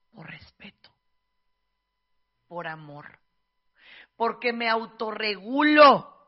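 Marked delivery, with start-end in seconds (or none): 0.1-3.2 whispered por respeto. Real, not acted. Answering why she doesn't stare, whispered. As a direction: breathe it out with no voice.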